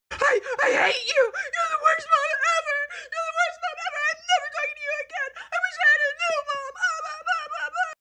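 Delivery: put-on very high hysterical child wail; hammed-up bawling, pitch way up; mock sobbing. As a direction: imitate a kid's crying tantrum. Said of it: "and she is sobbing".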